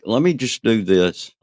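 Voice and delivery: ordinary delivery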